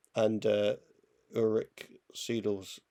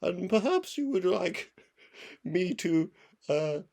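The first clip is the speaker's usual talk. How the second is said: higher-pitched posh comic-strip gent; posh pugilist; 6 semitones higher than usual. Acted. Friend imitates Viz character starting a fight, posh politeness. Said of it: "the posh guy who always tries to start a fight".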